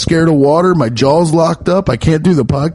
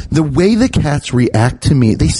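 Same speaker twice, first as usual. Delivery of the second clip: normal talking voice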